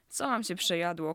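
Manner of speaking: usual speaking voice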